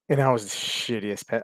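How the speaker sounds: ordinary talk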